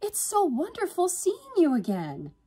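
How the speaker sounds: very high-pitched joyful greeting; overenthusiastic. A narrator's reading. Yvonne greeting her sister's friend, overenthusiastic. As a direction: gush it way up high. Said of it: "with her overenthusiasm".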